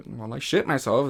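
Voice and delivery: usual speaking voice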